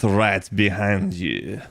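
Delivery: put-on slow, low French accent. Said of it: "In a French accent".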